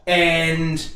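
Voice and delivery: usual speaking voice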